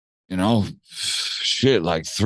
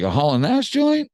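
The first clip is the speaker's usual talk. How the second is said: incredulous echo; 12 semitones higher than usual. Retold or imitated. Mocking the producer's baffled echo of the title. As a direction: echo it back in shock. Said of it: "He's like".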